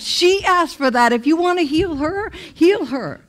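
high, loud insistence; mad protest. Retold or imitated. Telling God to heal the other woman, high and loud. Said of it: "And I was so mad".

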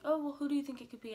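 lightly voiced eager friends, quick and high; quoting friends. Friends pressing her about the hacker in a quick high mock voice.

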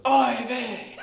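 high, laughing "Oy vey"; a shocked old father throwing up his hands; dismayed father. Acted. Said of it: "And he goes".

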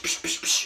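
whispery psh gunfire noises; imitated gunshots. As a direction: fire off quick unvoiced psh shots. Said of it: "he's the king of psh".

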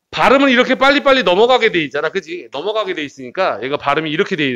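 ordinary talk, nothing marked

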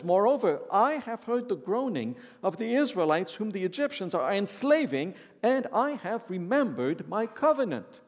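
preacher voicing God reading Exodus; scripture reading. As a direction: proclaim God's words to the congregation. Reads God's words from scripture.

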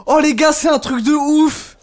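real astonished exclamations, high and stretched; stunned excitement. Real, not acted. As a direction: call out to chat in amazement. Streamer stunned by absurd situation.

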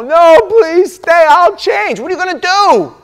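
high, loud mock begging; hammed-up plea to stay; pleading partner. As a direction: beg them not to leave.

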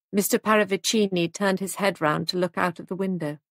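ordinary delivery, nothing marked

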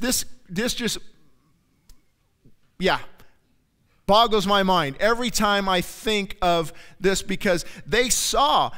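ordinary delivery

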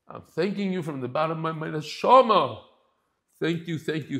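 choked-up thanks. Real, not acted. Rabbi reading a grateful email to his class, close to tears. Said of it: "I'm already tearing up".

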